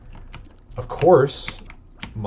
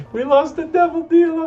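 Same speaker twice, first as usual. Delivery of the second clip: much higher, anguished wail at the loss; dismayed cry; 4 dB louder than usual. Real, not acted. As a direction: cry out like the run just died. Game mistake, cries out in dismay.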